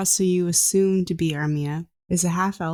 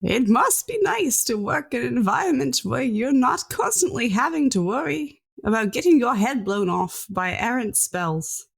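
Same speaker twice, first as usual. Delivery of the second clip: put-on sneering old scientist; 6 semitones higher than usual. Scientist needling Alara.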